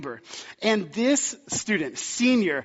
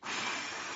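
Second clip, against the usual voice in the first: long breathy exhale; heavy sigh; 15 dB quieter than usual. Retold or imitated. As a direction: let out a long sigh before replying. Fuming pastor holds himself back.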